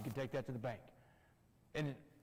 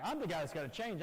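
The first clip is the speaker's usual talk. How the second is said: ordinary delivery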